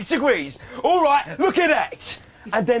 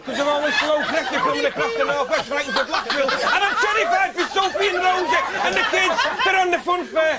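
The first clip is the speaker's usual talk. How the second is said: comic soap-character voice, rising higher; Kevin Webster; 6 semitones higher than usual. Acted. Soap character terrified for the kids on the fun fair, comic impression. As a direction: play the worried soap dad, pitched up. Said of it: "Kevin Webster from Coronation Street is gonna tell us".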